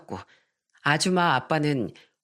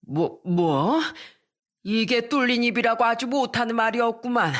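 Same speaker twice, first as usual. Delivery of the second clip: stunned, furious grandmother; 4 semitones higher than usual. A narrator's reading.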